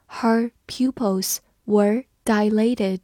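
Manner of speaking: slow, careful read of the sentence. Shown as a demonstration. Teacher reading slowly for students to follow. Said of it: "我们慢读一遍".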